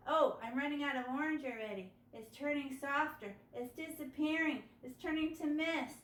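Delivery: usual speaking voice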